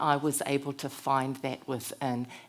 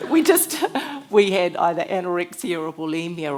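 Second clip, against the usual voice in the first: laughing; 9 dB louder than usual. Real, not acted. Laughing at her own joke.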